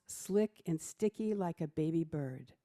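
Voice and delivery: ordinary talk, nothing marked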